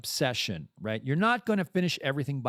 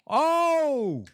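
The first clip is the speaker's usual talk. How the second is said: long, high-pitched "ohh" shout; loud drawn-out high holler; loud "oh" yell; 14 semitones higher, 5 dB louder than usual. Pretend. Exclamation after calling out.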